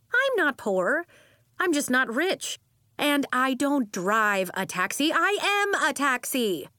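high, emphatic self-defence; indignant correction. A narrator's reading. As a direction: set them straight with proud indignation. Aunt indignantly corrects her niece.

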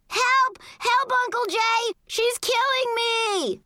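put-on high kid's shriek, drawn out at the end; screaming for help. A narrator's reading. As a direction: scream for a grown-up to save you. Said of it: "Kevin screamed".

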